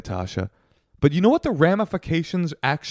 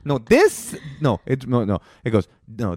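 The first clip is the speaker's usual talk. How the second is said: normal talking voice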